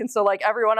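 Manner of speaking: normal talking voice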